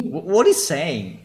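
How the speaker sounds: high, baffled question. Real, not acted. Streamer puzzling over a dialect clip, baffled. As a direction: demand to know what was said.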